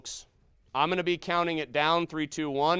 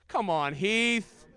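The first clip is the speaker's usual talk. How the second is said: usual speaking voice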